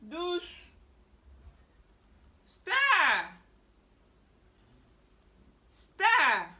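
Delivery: calling loudly across the room, Star stretched. Real, not acted. Mother calling daughter Star to the bath, loud.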